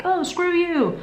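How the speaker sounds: drama queen. Retold or imitated. Imagined drama queen reaction.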